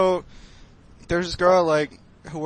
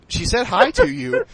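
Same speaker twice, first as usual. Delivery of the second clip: high, louder, eager telling; eager mom; 10 semitones higher, 4 dB louder than usual. Retold or imitated. Mom telling her son, excitedly raised voice. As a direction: mom breaking the news eagerly. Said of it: "And she's like".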